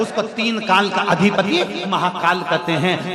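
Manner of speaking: loud, driving sermon delivery; full-force preaching. Acted. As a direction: preach it out to the whole crowd.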